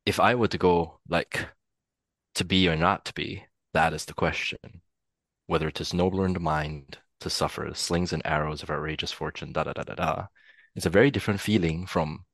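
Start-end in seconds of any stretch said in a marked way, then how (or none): 2.3-10.3 lower, understated American-accented recital. Shown as a demonstration. Actor demonstrating how accent shifts a Hamlet soliloquy, American accent. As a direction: recite it with American R's and vowels. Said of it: "if I do it in an American accent".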